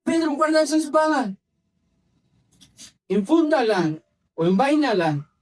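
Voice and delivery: high-pitched acted order, mildly stern; forceful command. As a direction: act out a firm rebuke. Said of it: "le ha de haber dicho bien fuerte".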